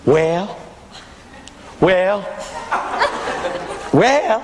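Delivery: drawn-out approving "well"; imitated church women. Women with one hand in the air answer preacher, imitated approval. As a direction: mimic an admiring congregant's response. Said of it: "and they go".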